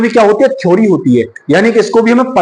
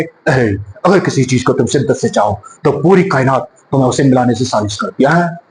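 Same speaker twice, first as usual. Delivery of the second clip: deep, heavy baritone dialogue; Amitabh Bachchan impression; 8 semitones lower, 3 dB quieter than usual. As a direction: boom it out like Big B. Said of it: "अगर बच्चन साहब बोलेंगे तो क्या, कैसे बोलेंगे". (अमिताभ बच्चन की भारी, गहरी आवाज़ में डायलॉग)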